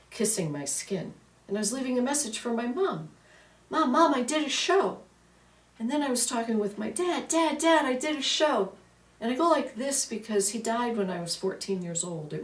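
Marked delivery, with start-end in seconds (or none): 3.7-5.0 excited. Retold or imitated. Excited message to her mom. 7.3-8.7 excited. Retold or imitated. Excited calling to her late dad.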